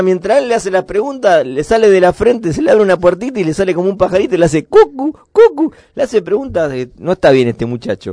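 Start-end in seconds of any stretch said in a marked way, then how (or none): 4.3-5.7 cuckoo call. Retold or imitated. Cuckoo bird sound from forehead.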